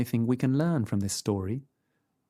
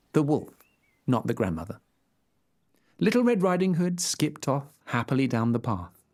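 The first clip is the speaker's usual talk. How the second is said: usual speaking voice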